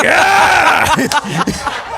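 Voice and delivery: high laughing yell; mock yell. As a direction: yelp it out, laughing. Mock shout at guest.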